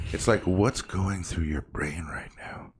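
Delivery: slow unvoiced whisper. Whispering what strangers in line are thinking. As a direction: breathe it out like a secret. Wondering about people staring at phones.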